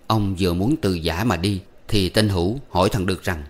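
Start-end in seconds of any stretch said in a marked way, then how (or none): none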